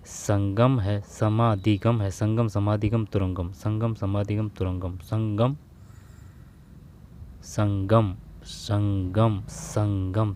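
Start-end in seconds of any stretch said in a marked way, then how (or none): none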